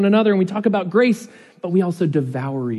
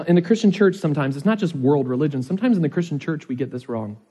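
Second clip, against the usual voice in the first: normal talking voice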